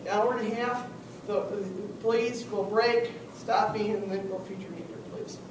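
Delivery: ordinary delivery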